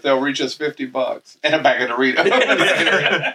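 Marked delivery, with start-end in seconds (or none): 1.8-3.3 laughing. Real, not acted. Laughing plug for Doritos.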